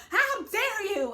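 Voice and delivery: hammed-up drunk fury, leaning on HOW; mock outraged yell. Drunk castmate confronting at dinner.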